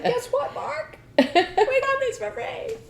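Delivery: high, gleeful and laughing, slowing on free; excited. Retold or imitated. Telling Mark the drinks were free, gleeful and excited. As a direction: burst in thrilled with the news.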